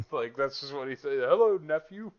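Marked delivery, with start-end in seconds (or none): 1.2-2.1 high, loud rapper-uncle greeting. Acted. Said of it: "He's like [name], but, like, Olympian version".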